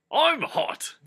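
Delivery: high, loud sudden squeak; Gandalf's silly announcement. Acted. Gandalf announces to Frodo he's hot, high squeak.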